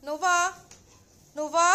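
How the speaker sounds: loud call for the cat, rising at the end. Real, not acted. Owner calling her pet Nova, loud rising call. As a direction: call her name across the room.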